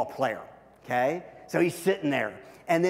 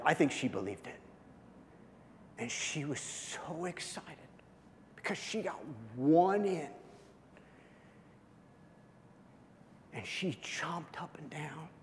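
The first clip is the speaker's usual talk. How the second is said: hushed tender memory; 3 semitones lower, 11 dB quieter than usual. Real, not acted. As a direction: tell it softly, moved by the memory.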